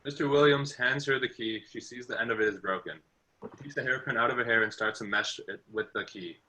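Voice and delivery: usual speaking voice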